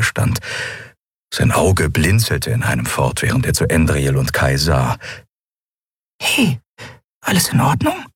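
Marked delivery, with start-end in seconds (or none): none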